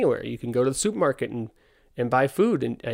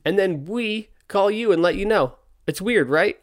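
higher, louder, cheery official voice; amused fire official; 6 semitones higher, 4 dB louder than usual. Retold or imitated. Fire department caller telling owner about complaint, cheery official voice. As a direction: play the fireman breezily breaking the news. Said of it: "the guy's hilarious".

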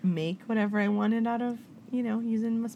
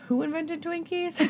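high, silly voice asking about Twinkies; a high goofy pipe; dopey question; 9 semitones higher than usual. Retold or imitated. Daydreaming in law school, she asks in a high goofy voice. Voicing herself as bored student.

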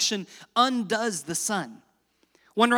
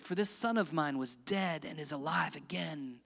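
normal talking voice

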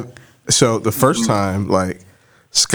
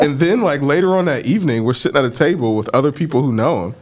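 ordinary talk, nothing marked

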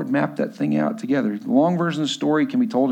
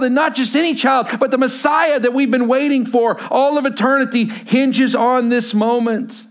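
loud, driving sermon delivery, higher and louder; full-force preaching; 10 semitones higher, 5 dB louder than usual. Acted. Pastor preaching Luke to his church, loud and driving. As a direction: preach it out to the congregation with fire.

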